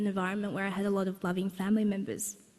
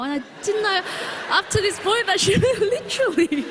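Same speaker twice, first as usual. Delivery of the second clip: higher and louder, dissolving into laughter; laughing; 12 semitones higher, 3 dB louder than usual. Real, not acted. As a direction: laugh through the words until they give out. Breaks into laughter at memory.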